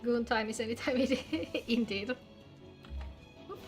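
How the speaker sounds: normal talking voice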